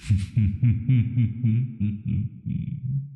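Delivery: light rising chuckle; a fond little laugh; amused laugh. Acted. Amused by sleight of hand.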